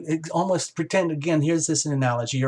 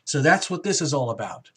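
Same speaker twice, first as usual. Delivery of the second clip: ordinary delivery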